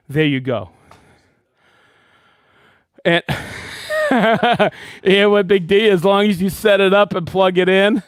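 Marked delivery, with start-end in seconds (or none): 3.3-4.1 breathy laugh. Real, not acted.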